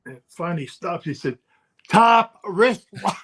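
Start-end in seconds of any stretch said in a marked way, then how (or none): 1.9-3.2 higher, louder, slow "Top wrist lock"; loud command. Retold or imitated. Trainer repeating "Top wrist lock" into Bob's face, louder. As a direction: bark the hold right in his face. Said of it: "To my face".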